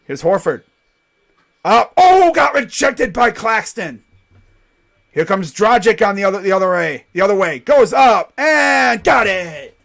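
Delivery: loud, high calls stretched on the big moments; live play-by-play. Real, not acted. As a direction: shout the action as it happens.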